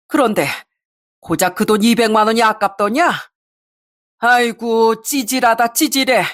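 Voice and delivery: lightly voiced exasperated father; scolding father. Father to son-in-law who came for money, berating.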